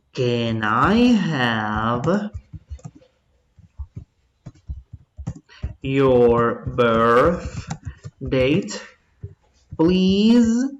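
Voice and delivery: very slow, with long gaps between the words; slow dictation. Shown as a demonstration. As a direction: dictate it piece by piece while writing. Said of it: "Escribámoslo, pues". (dictado muy lento con pausas)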